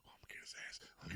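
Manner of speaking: fast, hushed whisper; scheming whisper. Retold or imitated. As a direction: plot revenge under your breath. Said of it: "every time I learned something new".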